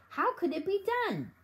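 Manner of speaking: high, eager question; burning to know how; excited cry. A narrator's reading. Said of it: "he cried".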